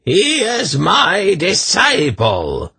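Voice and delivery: very high, slow frenzied cry; mad shriek. A narrator's reading. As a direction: screech it like an enraged madman. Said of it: "Irvan shrieked, starting to his feet".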